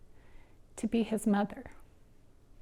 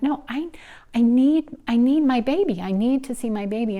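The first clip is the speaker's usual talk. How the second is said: put-on higher and louder, pleading for her baby; desperate plea; 4 semitones higher, 6 dB louder than usual. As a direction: beg them to let you see him. Mother begging to see newborn.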